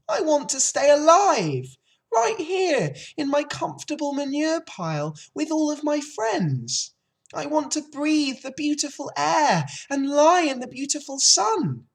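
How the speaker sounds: high, loud piglet lament; tearful wailing. A narrator's reading. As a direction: wail your plea as the despairing pig. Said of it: "Wilbur burst into tears".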